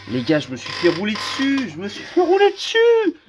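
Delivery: rising into a high, loud wail; hammed-up despair; comic lament of defeat; mock wail. Real, not acted. Crushed by opponent.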